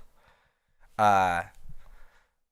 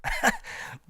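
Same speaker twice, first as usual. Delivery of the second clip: high laugh; 5 dB quieter than usual. Real, not acted. Laughing after the imitation.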